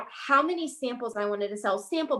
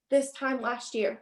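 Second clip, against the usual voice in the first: ordinary talk, nothing marked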